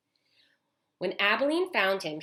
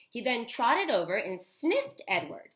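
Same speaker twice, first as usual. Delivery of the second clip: ordinary delivery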